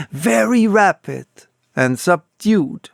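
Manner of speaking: plain reading voice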